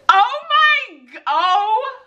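high, slow cry of joy; overwhelmed cry. Real, not acted. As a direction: let the joy break out. Overwhelmed by news.

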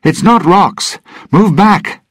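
loud, very high cry; shouting. A narrator's reading. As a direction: shout a sharp warning. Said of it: "he shouted".

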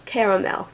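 "Caramel" with first syllable like "care"; trying out pronunciations. Shown as a demonstration. Asking viewers how they say "Caramel", CARE-uh-mel. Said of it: "How do you guys say it".

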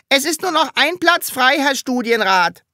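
high, loud schoolboy report; eager model pupil. Acted. Top pupil reporting to his teacher, eager and high.

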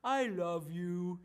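put-on slow, tender little-boy plea; sweet little boy. Caught son pleads to his dad, slow and sweet. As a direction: melt dad's heart, slowly and sweetly. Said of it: "and he'll say".

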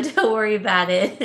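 usual speaking voice

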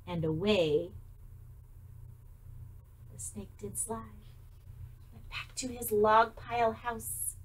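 ordinary delivery, nothing marked

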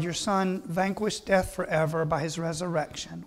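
normal talking voice

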